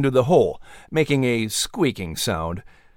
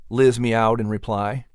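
plain reading voice

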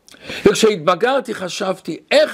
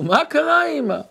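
put-on worried young son; 8 semitones higher than usual. Child questions his crying mother.

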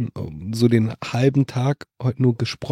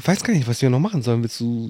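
ordinary talk, nothing marked

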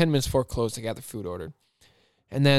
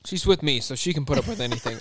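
usual speaking voice

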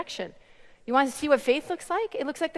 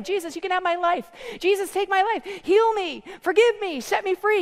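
higher-pitched pleading prayer; short, raised prayer phrases; demonstrated crying out; 6 semitones higher than usual. Acted. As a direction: model a heartfelt cry to God. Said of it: "You just cry out to him".

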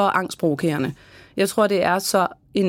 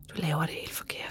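toneless whisper, much softer, like a nagging inner critic; 3 semitones lower, 13 dB quieter than usual. Retold or imitated. The small inner voice that says you're doing it wrong, whispered. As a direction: breathe it out as a hushed voice in your head. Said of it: "så vil man typisk høre den der lille stemme".

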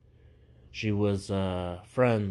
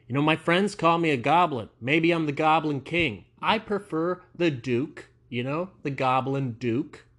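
mock grand boast; 6 semitones higher, 5 dB louder than usual. Boasting about being the Goblin King.

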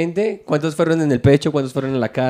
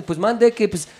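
higher, hesitant Mexican officer voice; imitated Mexican accent; 6 semitones higher, 3 dB louder than usual. Police answers the driver about parking, imitated Mexican accent. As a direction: put on a high Mexican voice. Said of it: "Qué bien lo hace".

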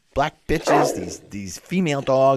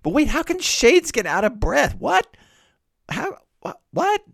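very high, sputtering what; baffled disbelief; 12 semitones higher than usual. Acted. Reader lost over breathless shades, sputtering in disbelief. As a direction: trail into high, halting, speechless questions. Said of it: "three what moments in which I, as a reader, go, What".

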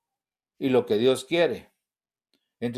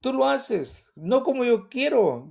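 raised grateful prayer; 7 semitones higher, slower than usual. Retold or imitated. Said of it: "yo digo".

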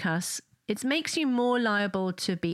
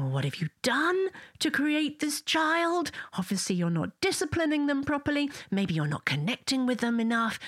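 judgmental onlooker; 6 semitones higher than usual. Retold or imitated. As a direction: mimic a disapproving stranger. Judging onlookers quoted to parents.

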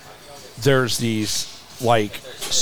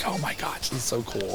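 whispered, much softer and breathless with wonder; awed whisper; 12 dB quieter than usual. Retold or imitated. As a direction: whisper it like you can't believe it. Retold awe on flight line.

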